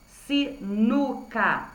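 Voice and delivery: "sinuca" slowly split into si-nu-ca; syllable by syllable. Shown as a demonstration. Teacher showing pupils the middle syllable, "sinuca" syllable by syllable. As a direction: spell out "sinuca" by slow syllables. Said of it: "Reparem que as sílabas com N".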